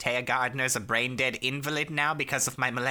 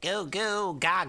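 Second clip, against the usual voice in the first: put-on baby voice; 7 semitones higher than usual. Baby voice for Baby Merrick.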